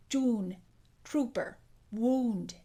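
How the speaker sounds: "June, trooper, wound" with really rounded lips. Shown as a demonstration. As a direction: round the oo in each separate word. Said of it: "This sound change involves really rounded lips".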